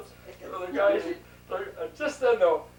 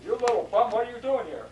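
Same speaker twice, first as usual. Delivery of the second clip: loud, raised-pitch mock outrage; retold challenge to a sneaking intruder, higher and louder; angry scolding; 5 semitones higher, 8 dB louder than usual. Man grabbing the boy as he sneaks out, angry scolding.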